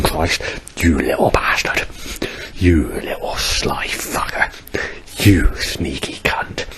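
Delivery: slow whispered curses through pain; hissed curses. Real, not acted. Whispering curses at God through the pain. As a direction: spit the insults out in a whisper.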